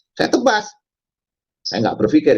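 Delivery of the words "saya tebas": short, high-pitched threat; retold threat. Attacker threatening to slash, high retold threat. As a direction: repeat the threat with pitch jumping up. Repeats his threat to behead.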